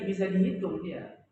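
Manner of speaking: ordinary talk, nothing marked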